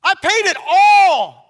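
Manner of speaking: loud, slow, triumphant declaration; ringing cry of victory; Jesus proclaiming. Acted. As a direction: proclaim it from the cross. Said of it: "he said".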